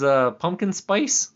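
ordinary talk

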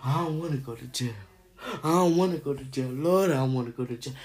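a hint of a sobbing uncle begging; mock crying uncle. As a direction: imitate a scared man half-crying for mercy. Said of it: "They crying".

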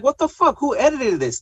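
high-pitched, incredulous reaction; baffled exasperation. Retold or imitated. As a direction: pitch leaps up in annoyed disbelief. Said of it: "I was like".

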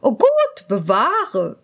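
shocked court lady. Acted. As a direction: gasp it out scandalised. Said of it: "sagte die Hofdame".

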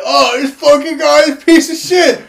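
high, loud, sneering couch-fan rant; mocking couch fan. Fan on the couch trashing a player, mocked in a high, loud voice. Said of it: "You're like".

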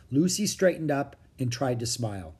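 usual speaking voice

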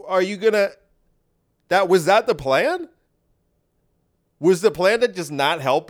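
high, incredulous complaint; exasperated. Acted. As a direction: demand answers in disbelief.

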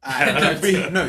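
laughing, loud protest. Real, not acted. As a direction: laugh through the denial. Laughing denial.

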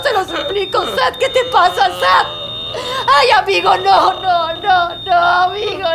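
a panicked woman calling for the nurse; desperate pleading. Acted. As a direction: beg him not to go, then yell for help. Friend convulsing, she begs and calls.

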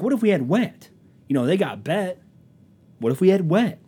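high, loud mock-earnest proposal; acted-out worked-up suggestion; insistent pitch. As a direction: push the odd idea harder. Acting out worker pressing idea.

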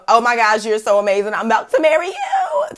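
high, lovestruck squeal rising to the end; mock gushing. Host mimicking a new couple about to marry, mock gushing. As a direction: gush like you are madly in love, hammed-up.

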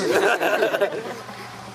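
laugh. Real, not acted. Laughs at his own punchline.

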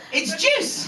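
high, rising reassurance; eager insisting. Retold or imitated. Eager boyfriend answers his grumpy girlfriend.

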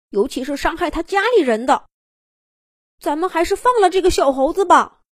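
high, fast nervous chatter; a worried monkey backing down. Acted. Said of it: "矮胖猴子紧张地说".

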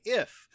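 "If" punched high and loud; a loud, high, isolated "If"; stress on If. Shown as a demonstration. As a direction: hit "If" hard as the word he did not say. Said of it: "James did not say".

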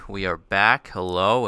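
punchy, loud show opener; loud announcement. Acted. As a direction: call it out loud and bright. Podcast opening, repeated louder.

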